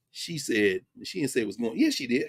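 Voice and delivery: normal talking voice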